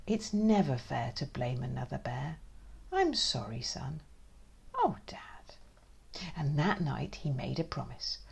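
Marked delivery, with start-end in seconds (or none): 2.9-4.0 quiet tender sorry; soft apology. Acted. Dad apologizing softly to his son. 4.8-5.6 high whispered oh dad; tearful whisper. Acted. Son to dad, touched and whispering.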